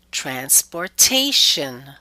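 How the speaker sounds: "transportation" slowly, with TA stressed and stretched; stress before suffix. Shown as a demonstration. Teacher shows the rule, slow "transportation" stressed on TA. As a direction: hit TA in "transportation", drawing it out. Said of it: "we stress the syllable immediately preceding it".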